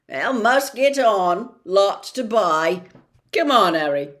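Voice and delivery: put-on giant hurrying the boy along; gruff Hagrid. Hagrid pulls Harry away.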